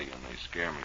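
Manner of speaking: ordinary talk, nothing marked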